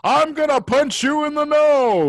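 high, loud 1920s brawler voice; old-timey tough guy. Pretend. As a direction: puff up like an old-timey boxer. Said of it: "All nineteen twenty style?".

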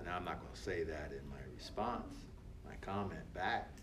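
quiet aside. Real, not acted. Aside about trolls.

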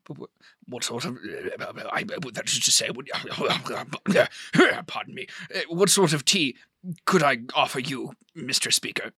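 stammering, sputtering false starts; tongue-tied host tripping over himself; flustered. Acted. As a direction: fumble for the words, then recover politely. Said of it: "kind of getting, like, a little, like, flustered".

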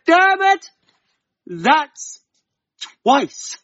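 otherworldly wife counting his second slip; fairy wife's warning. Acted. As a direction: call his name like a warning from beyond. Fairy wife warns again.

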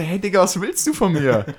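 high-pitched baffled protest; mock incredulous retort. Narrator reacts to being yelled at, high incredulous retort. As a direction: throw it back, exasperated. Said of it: "So nach dem Motto".

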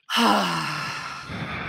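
long open-mouthed breath out; deep slow exhale. Shown as a demonstration. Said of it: "taking a couple big slow breaths".

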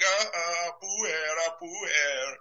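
high, soft mocking singsong of a teasing mom. Mom taunting her son with a high mocking singsong. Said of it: "his mom's teasing him like".

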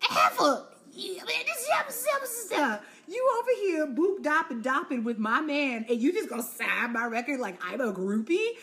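high, put-on sassy voice; mock her with exaggerated attitude; mock sassy Drew. Vlogger voicing guilty Drew signing for Melanie, high and sassy. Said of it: "And Drew was like".

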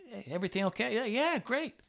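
high-pitched, upbeat small talk; retold cheery greeting. Teacher and visitor greeting at the bus, high and cheery.

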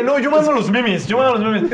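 loud high mocking imitation; mocking a friend. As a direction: parrot him in a loud jeering voice. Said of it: "Este güey que".

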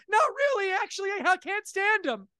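a cheery father undercutting himself; dad admitting. Retold or imitated. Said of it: "He goes".

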